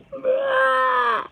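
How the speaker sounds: high mock monster scream. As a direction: shriek to scare the kids. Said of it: "screamed Bleep".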